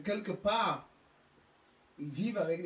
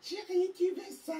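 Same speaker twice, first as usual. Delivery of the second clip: much higher, sing-song indulgent mother; doting mother; 14 semitones higher, 3 dB louder than usual. Retold or imitated. Rich mother answering her demanding child, high doting coo. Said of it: "et elle, elle dit".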